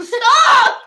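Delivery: high loud yelled stop; shouted stop. Real, not acted. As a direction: shout it to cut them off. Yelling at cohost's noise.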